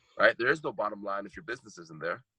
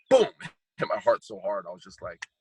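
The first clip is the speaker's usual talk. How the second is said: ordinary delivery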